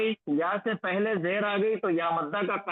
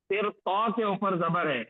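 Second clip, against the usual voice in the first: usual speaking voice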